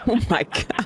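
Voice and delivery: real amused shock; laughing disbelief. Real, not acted. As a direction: gasp it out through laughter. Laughing at soft landing remark.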